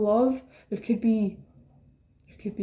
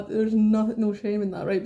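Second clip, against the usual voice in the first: ordinary talk, nothing marked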